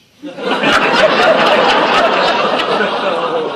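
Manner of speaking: very loud high roar of laughter. Real, not acted. Laughing very loudly after the brain damage punchline. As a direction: explode laughing.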